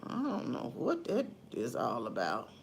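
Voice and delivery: very low, soft mutter fading to a whisper; hushed, private grumble; muttered aside. Real, not acted. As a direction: dismiss it under your breath, low and quiet. Dismissive aside about troll's nonsense.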